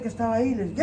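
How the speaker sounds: ordinary delivery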